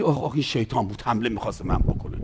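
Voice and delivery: fast, soft and low, spooked; mock fright. Preacher mocking a believer who fears a shout, fast and hushed. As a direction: hurry it out like a jumpy believer. Voicing believer scared by devil.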